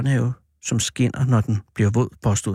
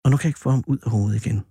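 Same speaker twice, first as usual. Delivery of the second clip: usual speaking voice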